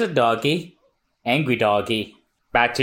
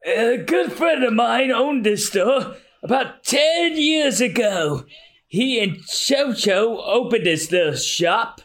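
slow, measured storytelling elder; drawn-out old-timer recollection; old mayor reminiscing; 10 semitones higher, slower than usual. Acted. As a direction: recall an old friend at an unhurried pace.

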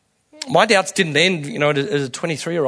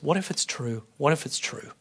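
softer, nagging murmur, voicing doubt; whispered doubt; 10 dB quieter than usual. Doubt murmuring to the congregation, soft and nagging. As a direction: let the doubt creep in quietly. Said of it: "Doubts constantly whisper to us".

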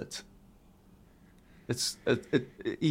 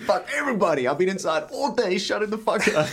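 voicing the dog; 7 semitones higher than usual. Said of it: "it's like".

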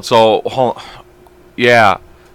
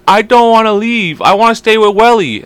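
put-on whiny child; 10 semitones higher, 4 dB louder than usual. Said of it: "She goes".

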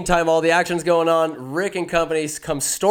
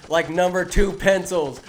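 ordinary delivery

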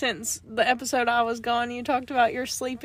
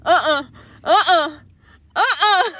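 sleep-talking yelps; 2 semitones higher, 6 dB louder than usual. Retold or imitated. Husband imitating wife's sleep-talk, loud mock protests. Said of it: "in your sleep, you're going".

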